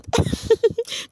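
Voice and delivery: high burst of real laughter; helpless laugh. Real, not acted. Vlogger breaks off over housemates hiding food, helpless laughter. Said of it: "I can't stop laughing".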